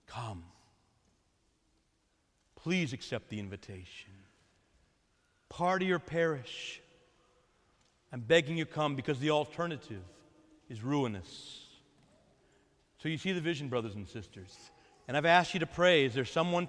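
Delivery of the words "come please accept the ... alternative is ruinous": soft pleading. Acted. The Lord begging his people to come, hushed and pleading. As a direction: lower and much softer, a hushed plea. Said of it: "The Lord is pleading".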